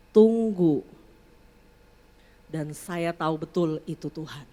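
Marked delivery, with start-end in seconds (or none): 0.1-0.8 clear voice of God. Retold or imitated. God telling the angry mother to hold back, clear and firm. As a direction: say it as one clear, spontaneous word. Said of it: "clear, bilang begini".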